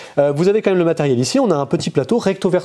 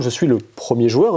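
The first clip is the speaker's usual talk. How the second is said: ordinary talk, nothing marked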